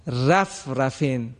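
رفرفین with a thin, light ر, slow; thin ra mistake. Shown as a demonstration. Teacher showing the error of a thin ر in رفرفین. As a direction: demo the wrong reading رفرفین with thin ر. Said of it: "آمد تلفظ کرد".

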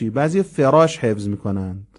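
ordinary delivery

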